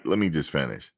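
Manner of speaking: ordinary talk, nothing marked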